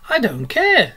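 a loud stubborn retort; dogged. Acted. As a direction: plant your feet and refuse. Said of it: "said Rat doggedly".

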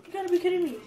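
normal talking voice

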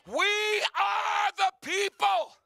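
very high, loud, stretched-out declaration; preaching shout. Real, not acted. Preacher telling the church God will use them, rousing shout. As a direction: shout it slowly to the whole church.

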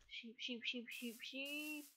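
hushed calling under breath; whispering. Real, not acted. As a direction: whisper the word over and over.

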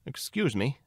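high, tentative interjection; timid, unsure bunny; uncertain. A narrator's reading. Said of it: "said Lily Bunny uncertainly".

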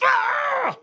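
imitated high, explosive yell; volcanic roar. Father flipping from chat to rage, volcanic yell. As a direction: erupt in a mock-furious scream. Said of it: "it was just volcanic".

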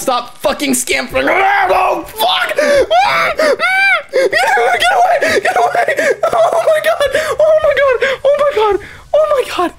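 very high, loud, half laughing, half crying; hysterical screaming. Real, not acted. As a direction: shriek in real hysterical panic.

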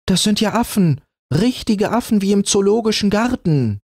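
put-on high, bright girl's voice calling out; excited little girl. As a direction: cry out like an amazed child. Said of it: "rief Lieselotte".

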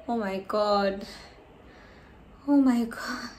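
usual speaking voice

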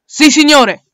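crisp loud salute to the captain; soldierly yes sir. Acted. Soldier answering his commander, loud and crisp. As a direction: snap off a loud military reply.

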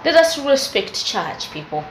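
ordinary talk

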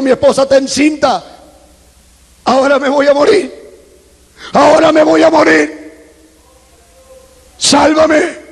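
high-pitched, mounting plea to God; desperate plea. Retold or imitated. Súplica desesperada a Dios. (súplica desesperada, cada vez más aguda)

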